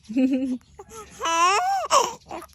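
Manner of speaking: real high-pitched laughing cry; laugh mixed with crying. Real, not acted. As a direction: laugh that tips into a wail. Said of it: "Yung tawa mo may halong cry".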